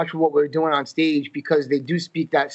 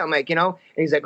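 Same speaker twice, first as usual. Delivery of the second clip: usual speaking voice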